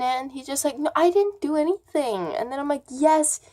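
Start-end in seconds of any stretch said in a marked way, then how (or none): 0.8-2.3 higher-pitched imitated protest; retold denial. Accused boy answers his mom, imitated denial. 2.9-3.4 high-pitched re-enacted accusation; retold insistence. Girl contradicts the boy before his mom, retold insistence.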